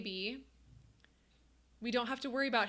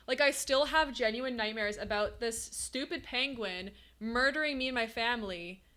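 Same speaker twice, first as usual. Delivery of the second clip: ordinary delivery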